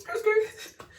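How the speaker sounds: very high skrrt sound effect; tire screech imitation. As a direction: mimic screeching tires. Imitating tire screech while driving.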